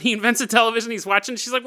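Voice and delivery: ordinary talk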